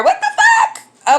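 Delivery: high, slow disbelieving squeak; shocked squeal. Retold or imitated. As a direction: stretch it out in disbelief. Shocked at the word rider.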